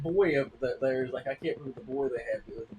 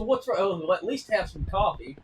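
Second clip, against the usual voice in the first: higher, louder pleading wife; chirpy offer of coffee; wife pushing coffee on husband, high and bright; mock sitcom wife; 6 semitones higher, 5 dB louder than usual. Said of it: "He said".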